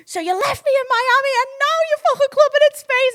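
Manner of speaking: very high, loud accusation; outraged yell. Retold or imitated. Girlfriend confronts boyfriend clubbing without her, outraged yell. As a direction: shriek it in mock outrage.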